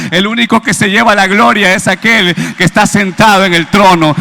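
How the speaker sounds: preacher proclaiming glory to God, at full force; full-force preaching. Acted. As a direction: drive it home to the congregation.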